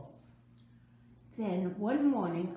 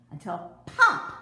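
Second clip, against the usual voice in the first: "pop!" said sharply, much louder; pop punched; 2 semitones higher, 10 dB louder than usual. A narrator's reading. Narrator acts the button popping off, loud and sharp. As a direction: snap out "pop!" like the sound.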